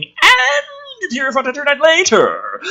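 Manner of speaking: high, loud hammed-up reading. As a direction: overplay it like a trailer voice. Mock dramatic reading.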